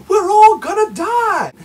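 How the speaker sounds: high, loud, slow hysterical wail; hammed-up panicked scream; mock panic. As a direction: shriek it like a terrified leader. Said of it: "tell you".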